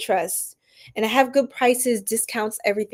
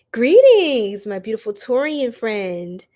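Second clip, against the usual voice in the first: ordinary talk, nothing marked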